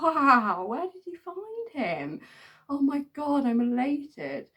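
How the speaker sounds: high, loud overjoyed reply; mock elation. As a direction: gush with joy on the phone. Excited reply to found dog.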